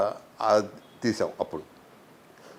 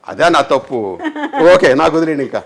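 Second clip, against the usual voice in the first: laughing admission; 3 semitones higher, 9 dB louder than usual. Retold or imitated. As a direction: own mistake owned up to through laughter. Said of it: "అని నేరుగా వెళ్ళి". (నవ్వుతూ తప్పు ఒప్పుకోవడం)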